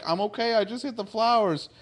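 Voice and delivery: high-pitched imitation of a boy; innocent kid. Wesley explains to the others, innocent. Wesley protests after his fall.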